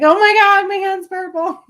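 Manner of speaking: high, loud panicked squeal; sudden high-pitched panic. Retold or imitated. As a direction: shriek it out in alarm at your own hand. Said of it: "I'm like".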